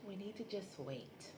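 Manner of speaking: a calm wife easing her husband's worry; calm reassurance. Retold or imitated. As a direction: soothe him, let it play out. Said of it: "she's so calm too".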